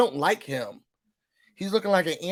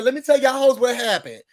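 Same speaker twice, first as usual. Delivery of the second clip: gossip announcement; 6 semitones higher, 5 dB louder than usual. Retold or imitated. Retelling British's big announcement.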